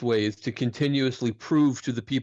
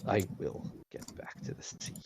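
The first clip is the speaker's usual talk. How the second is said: whispered vow; 3 semitones lower, 12 dB quieter than usual. Acted. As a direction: breathe it out as a promise.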